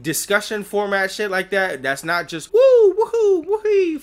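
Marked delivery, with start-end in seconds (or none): 2.5-4.0 high-pitched woohoo calls; Mario whoops. Retold or imitated. Mocking how the plumber should sound, high whoops. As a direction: yelp them out like a jumping plumber. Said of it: "Mario in a".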